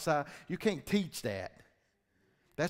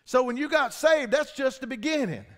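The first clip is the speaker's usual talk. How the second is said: preached loud; 9 semitones higher, 9 dB louder than usual. Shown as a demonstration. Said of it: "did y'all hear what I just said?".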